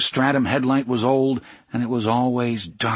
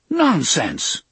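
higher-pitched indignant exclamation; mad; 6 semitones higher, 3 dB louder than usual. A narrator's reading. Engineer Bob objects to Charlie retiring, indignant. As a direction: burst out in mild, high-pitched outrage. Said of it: "Engineer Bob was mad".

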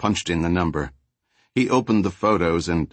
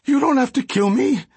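high, louder terrified begging; pleading; 4 dB louder than usual. Acted. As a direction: plead desperately not to die. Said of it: "Flacco pleaded".

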